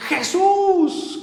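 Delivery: high, drawn out call to Jesus; crying out. Retold or imitated. Homeless man crying to heaven at 3 am, high cry. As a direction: cry the name up to the sky. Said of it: "Con unas fuerzas".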